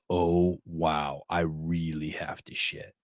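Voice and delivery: low, soft, slow realization; quiet inner voice. Retold or imitated. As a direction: slowly murmur the sudden realization. Sudden urge in the morning.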